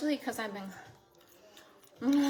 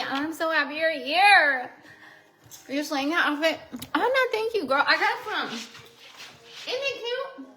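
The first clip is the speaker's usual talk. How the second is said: excited, flattered; 5 semitones higher, 9 dB louder than usual. Real, not acted. Viewer compliments her outfit.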